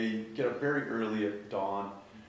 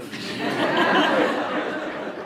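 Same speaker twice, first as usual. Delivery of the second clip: high, loud laugh; amused outburst of laughter; 11 dB louder than usual. Real, not acted. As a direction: laugh at yourself. Laughing at own judginess.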